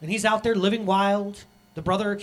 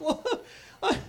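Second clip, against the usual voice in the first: much higher, laughing, hammed-up sinner owning up; sheepish confession; 10 semitones higher, 3 dB louder than usual. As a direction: play the embarrassed son laughing through his confession, then pleading. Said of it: "you're like".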